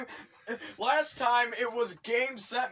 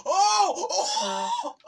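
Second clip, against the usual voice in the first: very high, laughing shrieks; astonished screams; 8 dB louder than usual. Real, not acted. Player saved at the last moment, high laughing shrieks. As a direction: shriek in disbelief.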